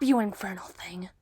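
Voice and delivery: contemptuous whisper; whispered sneer. Acted. Hunter sneering at his captive in a whisper. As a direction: hiss the insult in a whisper.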